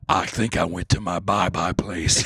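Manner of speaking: low, slow, spaced-out voice; mock dazed veteran. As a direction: play a veteran lost in his own head. Imitating the veteran.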